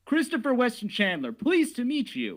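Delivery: high, loud mock introduction; Chris Chan. Streamer mocking a co-star's Chris Chan attempt, high-pitched loud mimicry. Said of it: "that's what he does with Chris Chan".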